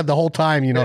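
ordinary talk

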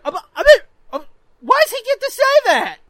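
high, loud sputtering whine; imitating a kid protesting unfairness; outraged brother. Brother to mom who is laughing, sputtering whiny protest. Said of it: "my brother's just like".

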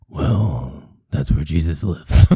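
low, deadpan doctor voice; deep doctor voice. Acted. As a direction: state it low and matter-of-fact. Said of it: "doing both voices".

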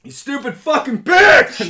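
very loud, high furious outburst; furious yelling. Pretend. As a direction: explode in rage at the machine. Losing it at the phone menu.

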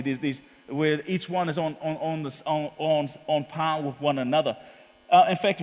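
normal talking voice

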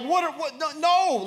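high, stammering comic panic; mock parental alarm. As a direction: sputter in protest, rising to a loud no. Alarmed father as child jumps.

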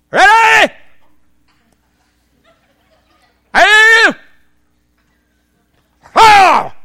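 loud, high, drawn-out military shouts; hammed-up drill sergeant yell; sergeant's barked orders. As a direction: bellow each command to the firing squad. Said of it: "The sergeant goes".

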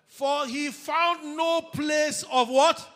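loud, high, drawn-out preaching shout; shouted scripture reading. Real, not acted. Preacher making the congregation finish the verse, high slow shout. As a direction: belt out the verse and demand the missing word.

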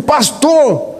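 high friendly call. Retold or imitated. Stranger calls out again.

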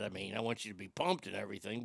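ordinary talk, nothing marked

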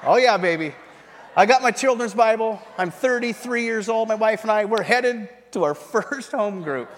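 loud, high, triumphant boast; mock bragging. Grown man boasts about his children's Bible, loud mock pride. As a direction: proclaim it proudly, playing up the joke.

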